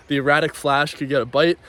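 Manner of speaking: normal talking voice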